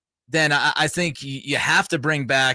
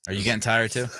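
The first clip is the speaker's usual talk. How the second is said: ordinary talk, nothing marked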